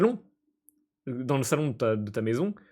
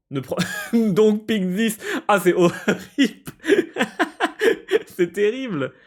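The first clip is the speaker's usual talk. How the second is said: high, loud laughing wail; laughing horror; 9 semitones higher, 6 dB louder than usual. Real, not acted. Player horrified at choosing children, laughing. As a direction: laugh through mock despair.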